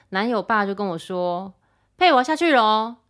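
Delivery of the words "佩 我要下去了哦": calling out. Retold or imitated. Father-in-law calling to her.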